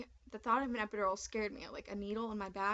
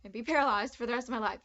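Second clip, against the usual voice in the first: nervous rush; 7 dB louder, faster than usual. Retold or imitated. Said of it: "So I was like".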